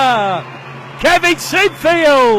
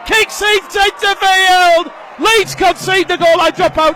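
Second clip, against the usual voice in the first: excited play-by-play; 4 semitones higher than usual. Real, not acted. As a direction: call the run like the crowd's on its feet. Break down the middle, live call.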